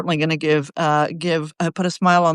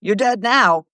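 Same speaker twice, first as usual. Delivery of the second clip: mocking; 6 semitones higher, much slower than usual. Mocking retort to Pakistan.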